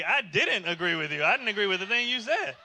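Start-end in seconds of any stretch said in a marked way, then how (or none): none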